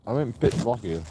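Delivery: ordinary talk, nothing marked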